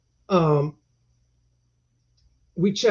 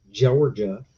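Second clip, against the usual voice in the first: usual speaking voice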